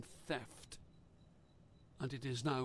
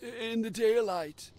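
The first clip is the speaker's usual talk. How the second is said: higher, louder rustic fisherman; old fisherman voice; 5 semitones higher, 3 dB louder than usual. A narrator's reading. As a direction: tell it like a haunted old sailor.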